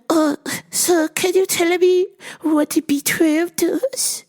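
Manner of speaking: slow, very high mock-accented customer; high-pitched comic caricature; Miss Swan. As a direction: imitate a customer asking in a drawn-out high voice. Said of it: "Miss Swan".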